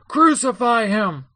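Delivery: high, slow, measured shout; crowd's shout. A narrator's reading. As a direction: call it out as a demanding mob. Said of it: "they kept shouting all the more".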